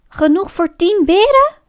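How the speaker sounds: high, excited question with a cracking voice. A narrator's reading. Bear hears of honey enough for ten, voice cracking. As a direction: let the voice leap up as if breaking. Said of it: "met een overslaande stem".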